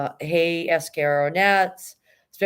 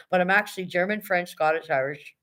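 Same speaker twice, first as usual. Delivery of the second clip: normal talking voice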